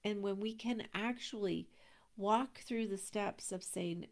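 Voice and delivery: usual speaking voice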